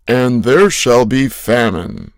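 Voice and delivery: loud prophetic declaration; scripture proclamation. Acted. Quotes Bible verse as prophecy.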